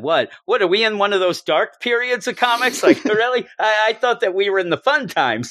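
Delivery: playful imagined retort; mock-incredulous Gwenpool. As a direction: act out her baffled disbelief. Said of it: "I wish she would have said".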